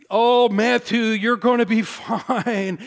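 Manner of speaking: high, bright reassuring voice through a laugh; forced cheer, laughing it off; cheery encourager. Retold or imitated. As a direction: play the upbeat dad cheering his hurt son on. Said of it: "I'm the encourager".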